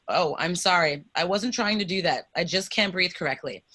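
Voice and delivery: usual speaking voice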